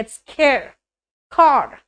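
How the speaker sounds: ordinary delivery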